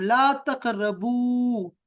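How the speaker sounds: teacher shows the form slowly; slow model answer. Shown as a demonstration. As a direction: model ला तक़रब slowly for the student. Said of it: "मैं कह रहा हूँ".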